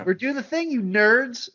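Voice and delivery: high-pitched mock boast to fans; lightly acted promo show-off. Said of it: "all the promotional images had him like".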